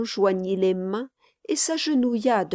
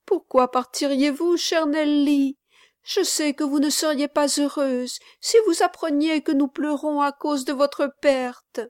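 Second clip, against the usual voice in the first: put-on high, earnest child's voice; childlike, insistent appeal to stay; pleading little boy; 7 semitones higher than usual. A narrator's reading. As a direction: entreat tenderly as a small boy. Boy keeps pleading with Nelly.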